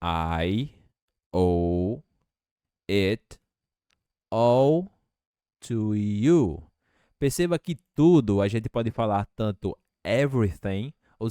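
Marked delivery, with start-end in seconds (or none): 0.0-6.6 each word said separately, slow; robotic, one word at a time; "I owe it all to you" broken into single words. Shown as a demonstration. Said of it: "vamos falar agora de uma maneira não robótica".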